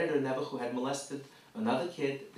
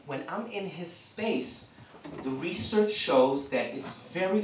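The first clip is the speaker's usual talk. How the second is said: ordinary talk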